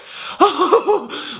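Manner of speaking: high, helpless burst of laughter; re-enacted laughing fit. Reviewer re-enacting his reaction to the ending, high laughing fit. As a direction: crack up in a high mock cackle. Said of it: "I just started laughing".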